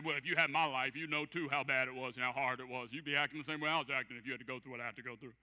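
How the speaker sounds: low, mock-defensive self-justifying; excuse-making. Person challenged on their attitude, mock excuse-making. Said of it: "their first response is an excuse".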